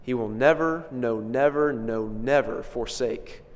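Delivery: never hit on each repeat, slowing at forsake; emphatic never. Shown as a demonstration. Preacher assuring the church God won't leave them, stressing never. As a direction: drive home each never, then slow down. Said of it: "This is obviously emphatic".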